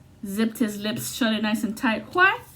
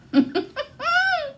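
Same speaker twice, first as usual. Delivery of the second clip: a high, delighted cackle; high burst of laughter. Real, not acted. Reader laughs at the card she drew, high cackle.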